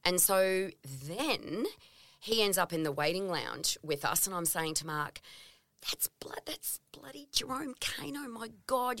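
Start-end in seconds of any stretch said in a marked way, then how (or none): 5.9-9.0 an excited whisper, much softer; starstruck whisper. Retold or imitated. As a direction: hiss it to your husband so he won't hear. Starstruck aside to husband in lounge.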